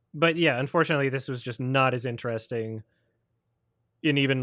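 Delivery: normal talking voice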